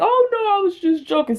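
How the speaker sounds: high, quick teasing doctor voice; jokey doctor impression. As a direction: mimic a doctor brushing it off as a joke. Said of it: "they'll be like".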